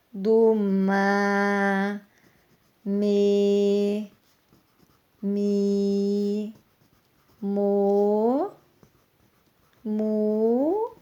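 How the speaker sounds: "ma, me, mi, mo, mu", each long-held and separate; syllable family recited. Shown as a demonstration. Teacher showing it is all syllables, drawn-out recitation. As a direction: recite "ma, me, mi, mo, mu" slowly with stretched vowels. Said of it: "É tudo sílaba".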